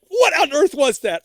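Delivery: high, loud disbelieving question; shocked. Retold or imitated. Asking herself after the naked neighbor, high shocked disbelief. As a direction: recoil in disbelief. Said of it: "I'm like".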